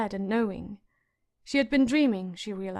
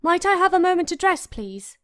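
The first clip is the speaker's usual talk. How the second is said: calling out; 8 semitones higher, 5 dB louder than usual. A narrator's reading. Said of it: "she called out".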